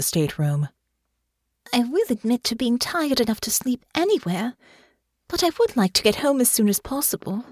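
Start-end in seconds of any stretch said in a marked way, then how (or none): 1.7-7.4 put-on higher-pitched, composed young character voice; Honor's voice. Honor admits she wants to get home soon, composed. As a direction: speak as Honor, pitched up from the narrator. Honor's dialogue in narrated fiction.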